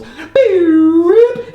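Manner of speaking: long, high, loud held noise; cartoon ending sound. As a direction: sustain a high cartoon sting.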